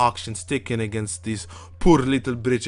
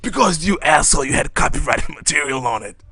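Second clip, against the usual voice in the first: loud mock scolding; 3 semitones higher, 7 dB louder than usual. Real, not acted. Scolding friend jokingly.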